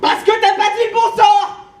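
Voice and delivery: loud, high scolding; exasperated scolding. Acted. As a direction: tell her off loudly. Scolding failed spell.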